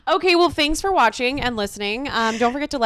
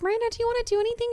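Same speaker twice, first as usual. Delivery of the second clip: retold eager question; 13 semitones higher, much faster than usual. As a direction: ask it high and fast, mimicking yourself. Imitates own asking of friend.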